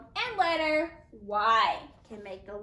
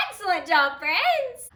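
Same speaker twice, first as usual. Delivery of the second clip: bright praise; 5 semitones higher, 5 dB louder than usual. Real, not acted. Praising the class.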